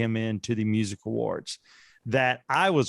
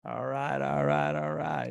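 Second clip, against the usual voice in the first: McConaughey impression; slower than usual. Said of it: "Matthew McConaughey".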